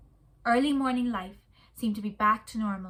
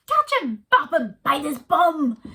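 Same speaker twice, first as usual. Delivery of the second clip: high loud cheering cries; animal shouts; 5 semitones higher, 10 dB louder than usual. A narrator's reading. Zoo animals cheering the kids, loud and high. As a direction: egg the kids on rowdily.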